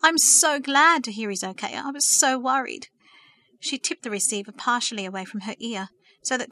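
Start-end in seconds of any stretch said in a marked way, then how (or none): 0.0-2.9 higher, louder gush of relief; joyful, voice breaking. A narrator's reading. Verity told Peter will be okay, overjoyed. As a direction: pour out relief, pitched up. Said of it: "sobs of joy breaking her voice".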